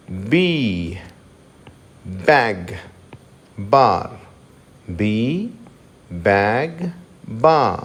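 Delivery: బార్ said as బా with no r; b without final r. Shown as a demonstration. Teacher shows b words with silent r. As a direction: drop the r in బార్. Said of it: "లాస్ట్లో ఆర్ శబ్దం లేనే లేదు".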